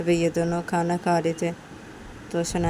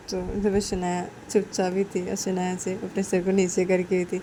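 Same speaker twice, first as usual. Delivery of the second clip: plain reading voice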